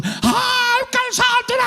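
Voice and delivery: high shrieking mock outrage over a pair of underwear; hammed-up panic, voice flying up into a shriek; mock outburst. Preacher mocking an overreacting spouse, shrill mock outrage.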